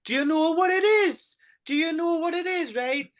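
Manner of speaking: high, repeated mock question; mimicking Jill. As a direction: copy Jill's eager question, pitched high.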